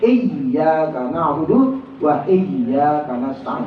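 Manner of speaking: slow reading, "iyyaka" doubled ya; drawn-out model recitation; careful tajwid; correct tasydid. Shown as a demonstration. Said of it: "Tasdidnya ada di ya".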